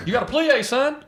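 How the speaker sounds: football coach drilling ballet dancers; yelling ballet coach. Retold or imitated. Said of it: "yelling at people how to plie".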